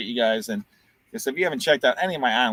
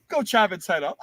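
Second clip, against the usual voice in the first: mock order to attack; 7 semitones higher than usual. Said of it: "They're like".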